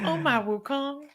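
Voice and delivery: put-on light Chinese accent on Wukong. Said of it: "Did you do a Chinese accent?".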